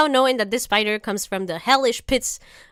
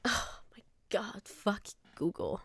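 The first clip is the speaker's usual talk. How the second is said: a breathless, much softer whisper of disgust; 16 dB quieter than usual. Real, not acted. Reacting to the spider search result, horrified whisper. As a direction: hush it out, horrified.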